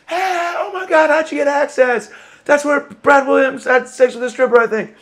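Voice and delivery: a shrill gossipy sidekick blurting out a rumor; squeaky intruder voice. Acted. Alter ego bursts into the green room, high excited yelp. Surprise character voice barges in.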